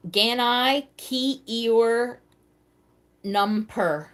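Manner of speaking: slow, word-by-word "Ganai Ki Eor Numper", each piece stressed apart; sounded-out wrong reading. Real, not acted. Host reads a nonsense puzzle clue aloud, sounding out "Ganai Ki Eor Numper" slowly. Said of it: "I said it wrong".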